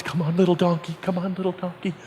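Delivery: soft, sneaky coaxing, fading away; hushed coaxing. Acted. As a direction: coax under your breath so nobody hears. Sneaking donkey away quietly.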